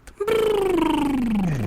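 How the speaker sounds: high trilled noise sliding down; imitated descending drone. Joking about an Amazon drone delivery, imitated falling trill.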